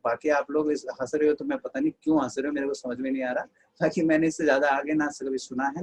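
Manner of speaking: normal talking voice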